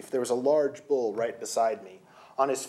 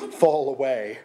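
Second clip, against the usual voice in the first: words through a laugh; 2 semitones higher, 5 dB louder, slower than usual. Real, not acted. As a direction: laugh as you say it. Narrator laughing mid-line.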